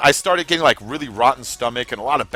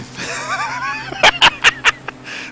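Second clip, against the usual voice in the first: high laugh. Real, not acted. Laughs at own joke.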